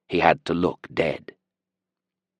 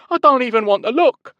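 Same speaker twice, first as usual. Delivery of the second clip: terrified; 7 dB louder than usual. A narrator's reading. Prisoner keeps up terrified act.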